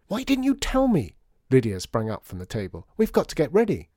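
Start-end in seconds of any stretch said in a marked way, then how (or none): 0.1-1.2 high startled cry; surprised. Acted. Lydia startled by queue news. 3.0-3.9 excited. Acted. Lydia rushing off.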